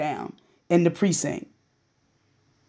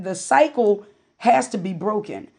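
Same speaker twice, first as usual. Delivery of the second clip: ordinary talk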